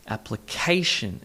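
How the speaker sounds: application slowly, stress on the third syllable; appli-CA-tion, drawn out. Shown as a demonstration. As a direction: lean on CA in application. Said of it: "emphasis on the second, uh, sorry, third syllable".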